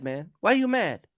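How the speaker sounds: ordinary talk, nothing marked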